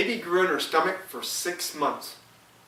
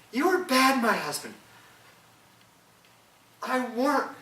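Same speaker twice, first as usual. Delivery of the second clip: wife, higher, reproachful; the wife blaming her husband, higher voice; grieving wife; 9 semitones higher than usual. Acted. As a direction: scold him high as the grieving wife.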